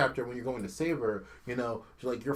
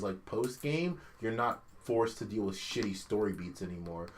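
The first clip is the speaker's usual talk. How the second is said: ordinary talk, nothing marked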